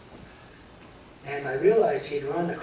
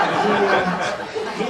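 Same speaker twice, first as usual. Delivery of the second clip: laughing; 5 semitones higher, 8 dB louder, much slower than usual. Real, not acted. Still laughing after the joke.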